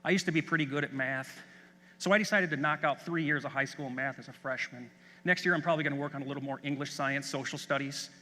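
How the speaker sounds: nonchalant. Retold or imitated. Sophomore telling visiting superintendents his story, nonchalant and offhand. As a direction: toss it off like it's no big deal. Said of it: "very nonchalantly says".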